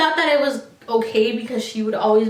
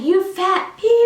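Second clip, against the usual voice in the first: much higher, louder, slow jab; insult yelled; 9 semitones higher, 4 dB louder than usual. Retold or imitated. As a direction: spit the insult at her. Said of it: "in my face".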